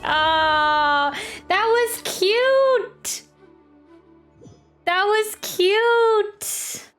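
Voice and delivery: ordinary talk, nothing marked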